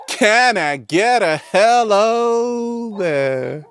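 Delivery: high, slow drawn-out call; hammed-up hello call; catchphrase call. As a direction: stretch it out like a showman's catchphrase. Catchphrase called out to audience.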